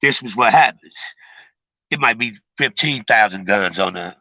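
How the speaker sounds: ordinary delivery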